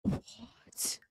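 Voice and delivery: breathless whisper; stunned whisper; whispered what. Real, not acted. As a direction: whisper it in disbelief. Shocked at the reveal.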